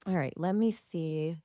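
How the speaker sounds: usual speaking voice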